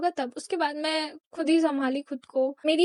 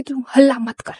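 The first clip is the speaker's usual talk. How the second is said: mildly raised voice imitating a telling-off; retold yell; 5 dB louder than usual. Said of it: "चिल्ला के बोल रहा है".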